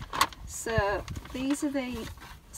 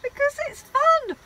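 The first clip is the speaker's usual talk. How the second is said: high, loud, cheery "fun!"; excited; 11 dB louder than usual. Real, not acted. Wife answers her husband's grumble, high and loudly cheerful. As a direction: sell it as a big treat, much higher and louder.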